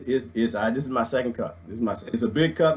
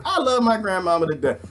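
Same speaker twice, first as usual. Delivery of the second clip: ordinary talk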